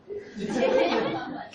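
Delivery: quick breathy chuckle; light laugh. Real, not acted. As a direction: laugh at your own joke. Teacher laughs at own joke.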